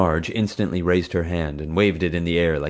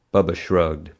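usual speaking voice

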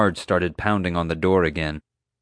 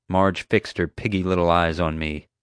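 ordinary delivery

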